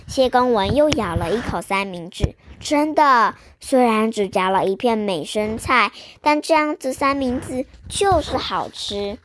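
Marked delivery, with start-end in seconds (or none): none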